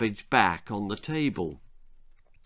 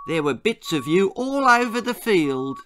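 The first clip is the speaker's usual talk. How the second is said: tactless nurse; 7 semitones higher than usual. Acted. Student nurse blurts out.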